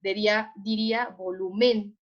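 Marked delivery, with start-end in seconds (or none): 1.2-2.0 "volumen" con fuerza en la última sílaba, vo-lu-MÉN; acento equivocado. Shown as a demonstration. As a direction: carga la voz en MEN al decir "volumen". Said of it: "colocamos el acento en la E". ("volumen" dicho como aguda, vo-lu-MÉN)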